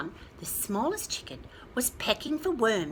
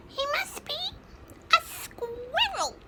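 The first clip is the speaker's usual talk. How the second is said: high, slow squeaky chick voice; a tiny chick sure of itself; peeping chick. A narrator's reading. Smallest chicken guesses a squirrel, high peep. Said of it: "he peeped".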